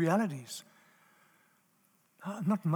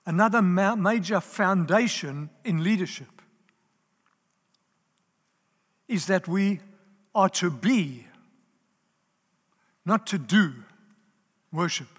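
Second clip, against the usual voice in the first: BE and DO punched louder, set apart by pauses; emphatic repeat; 6 dB louder than usual. Real, not acted. Preacher repeating the key point to the leaders, emphatic. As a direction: land hard on "be" and "do", pausing between phrases. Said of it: "let me repeat it".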